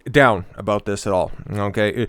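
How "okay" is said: ordinary talk, nothing marked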